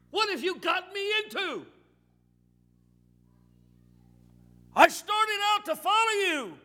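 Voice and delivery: high, loud, anguished outcry at God; frustrated shout. Acted. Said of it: "Peter was frustrated".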